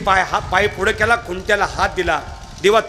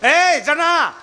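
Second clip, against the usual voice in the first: calling out loudly; 9 semitones higher, much slower than usual. A narrator's reading. God calling loudly to Janai inside the hut, high call. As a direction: call her name out high. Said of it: "मोठ्यानं ओरडले".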